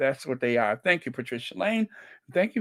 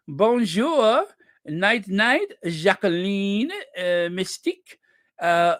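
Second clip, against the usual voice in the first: put-on French accent; 7 semitones higher, 6 dB louder than usual. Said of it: "my French, uh, accent turns me on".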